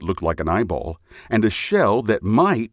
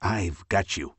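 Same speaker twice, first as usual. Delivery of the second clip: softer, hushed promise; whispered; 5 dB quieter than usual. A narrator's reading. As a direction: soothe the little buddy quietly. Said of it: "he whispered".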